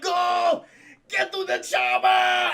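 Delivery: high, very loud drawn-out yell; imitating a movie hero's desperate shout; hammed-up action-hero shout. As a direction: bellow an urgent order to flee. Arnold yells the famous line.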